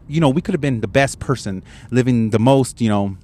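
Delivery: ordinary talk, nothing marked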